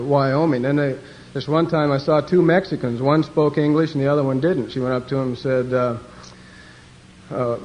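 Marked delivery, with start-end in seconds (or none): none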